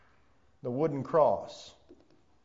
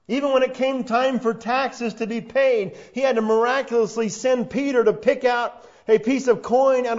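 loud, raised preaching voice, high and driving; preaching at full force; 8 semitones higher, 9 dB louder than usual. Acted. Preacher to his congregation, loud and driving sermon delivery. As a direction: preach it out to the whole room.

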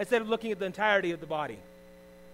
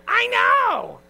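much higher and louder comic squeal; mock loud squeal; 14 dB louder than usual. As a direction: blurt it out in a shrieking mock protest. Wife's defensive reaction joked about.

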